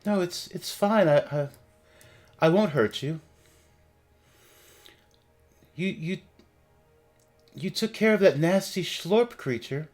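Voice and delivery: gentle spirit reassuring them; ghostly voice. Acted.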